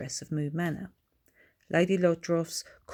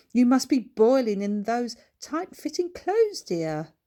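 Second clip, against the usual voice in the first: posh lady voice; 7 semitones higher, 6 dB louder than usual. A narrator's reading.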